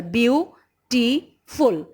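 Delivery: "Beautiful" slowly pulled into three parts; syllable by syllable. Shown as a demonstration. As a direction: say "Beautiful" as beau - ti - ful, one beat each. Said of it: "is made of three syllable".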